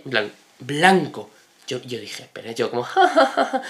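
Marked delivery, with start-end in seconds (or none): 2.8-3.6 high-pitched fake laugh; imitated nervous laughter, pitched way up; typical awkward laugh. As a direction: force out a polite, uneasy ha-ha. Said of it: "la típica risa".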